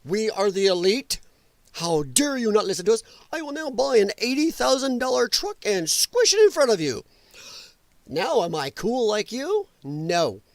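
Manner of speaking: sneering, snobby mock of the elite; mocking elitist. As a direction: play a smug elitist taunting people. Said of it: "looking at the American people going".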